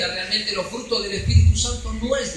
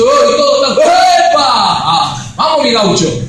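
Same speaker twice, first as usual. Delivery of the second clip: loud, high hammed-up showman prophet; mock prophet shout; 4 semitones higher, 11 dB louder than usual. Mocking a false prophet before the congregation, loud hammed-up shout. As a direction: bellow it like a showy prophet. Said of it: "Viene el profeta y dice".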